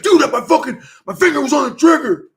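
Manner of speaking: high, loud defensive yell; mock brother yelling his excuse; brother's high excuse. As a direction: whine it back like you're caught out. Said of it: "And he's like".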